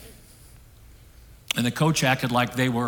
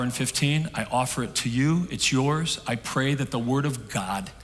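closing prayer. Acted. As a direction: pray over the congregation, heartfelt.

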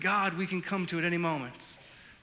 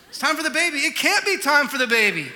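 loud, higher with a laugh; can't believe it's time; retold disbelief; 8 semitones higher, 10 dB louder than usual. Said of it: "hospital exchange acted out".

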